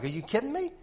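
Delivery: high, soft disbelieving exclaim; incredulous. Retold or imitated. Retelling the random thought, high disbelief. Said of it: "Just like I said it outside".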